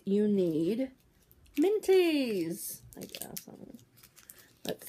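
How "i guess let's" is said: breathy whisper to herself; whispered aside. Real, not acted. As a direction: let it slip out as a whisper.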